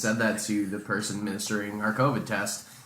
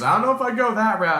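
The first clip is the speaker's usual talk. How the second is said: amused retort; 9 semitones higher, 4 dB louder, faster than usual. Retold or imitated. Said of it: "To which she laughed".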